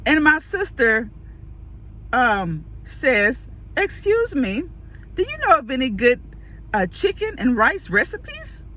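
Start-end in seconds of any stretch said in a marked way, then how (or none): 3.8-8.6 high-pitched innocent question; sincere. Retold or imitated. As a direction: imitate a sister asking in all sincerity. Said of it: "she was just as sincere".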